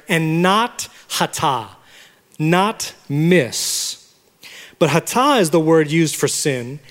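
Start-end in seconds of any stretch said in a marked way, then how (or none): none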